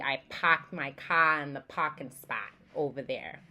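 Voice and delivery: non-rhotic Boston example sentence; careful Boston accent demo. Shown as a demonstration. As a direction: drop the R's like a Bostonian. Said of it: "a sentence would be".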